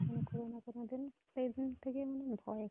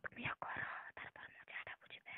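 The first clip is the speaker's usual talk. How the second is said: hushed teasing whisper; 8 dB quieter than usual. Real, not acted. She whispers a tease down the phone. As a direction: breathe it low so no one hears.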